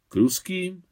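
a startled echo, pitch jumping up on ruským; surprised question. A narrator's reading. Surprised echo of her claim.

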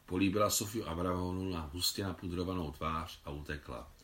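usual speaking voice

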